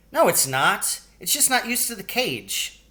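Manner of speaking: put-on high insistent little boy; arguing child. A narrator's reading. Billy arguing with his brother, high insistent child voice. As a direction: argue back like a stubborn kid.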